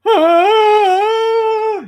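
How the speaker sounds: long, high, loud strained cry; weird dog noise. Retold or imitated. Imitating his dog's weird noise, long high cry. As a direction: imitate the dog's odd wail. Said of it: "making weird-ass noises".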